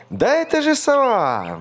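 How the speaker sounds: very high, loud cry; mouse shouting. A narrator's reading. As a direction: call out spotting the owl. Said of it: "мышонок закричал".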